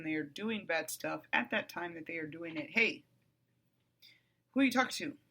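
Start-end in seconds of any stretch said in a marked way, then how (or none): none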